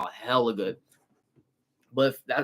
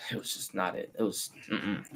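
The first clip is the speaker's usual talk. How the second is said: weary sigh; 6 semitones lower, 11 dB quieter than usual. Real, not acted. Sighing about Georgia weather.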